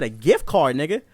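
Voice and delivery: incredulous, rising to a high angry demand; mad. Retold or imitated. Customer refusing a gift card, mad. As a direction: throw the offer back, indignant. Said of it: "I was mad as shit".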